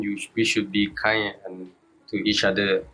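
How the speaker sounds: usual speaking voice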